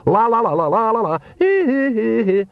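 high-pitched singsong nonsense shout; acted shouting, climbing shrilly high on the hees; shouted babble. A narrator's reading. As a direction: drown out the thought with frantic la-las. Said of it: "shouted Nanny".

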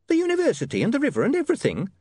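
high, anxious landlady; nervous, fretting landlady. Acted. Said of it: "said the landlady nervously".

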